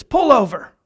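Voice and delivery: retold angry command; incensed. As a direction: order it like an incensed biker. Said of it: "And he was incensed".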